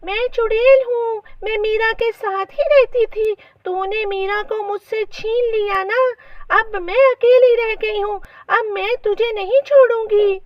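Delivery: high, menacing witch; haunt her in a high, vengeful witch voice. A narrator's reading. Fairy as witch accuses mother-in-law, high and vengeful. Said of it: "परी चुड़ैल की आवाज निकालकर कहती है".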